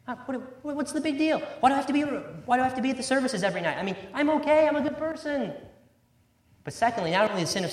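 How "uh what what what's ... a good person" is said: higher, faster, brushing it off; self-satisfied shrug of a voice, pitched up; smug self-righteous voice. Acted. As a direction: play the smug churchgoer who sees no problem. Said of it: "Self-righteousness".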